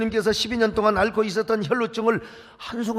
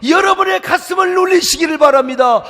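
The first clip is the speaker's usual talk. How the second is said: ordinary delivery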